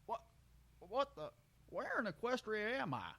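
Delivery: high, bewildered country stallion voice; confused stallion. Acted. Brayburn waking in a cage, high and bewildered. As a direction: wake up lost and puzzled.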